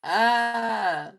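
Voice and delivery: loud, high sudden "Ah!"; loud yelp. Real, not acted. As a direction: let out a startled yell. Sudden outburst after laugh.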